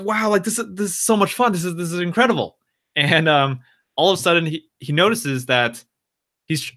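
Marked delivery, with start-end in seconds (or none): 0.0-2.6 high, gushing mock delight; retold gambler's giddy excitement; thrilled. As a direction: marvel at the winnings like a kid. Drunk man thrilled by winning.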